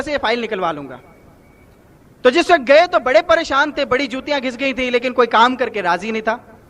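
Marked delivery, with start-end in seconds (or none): none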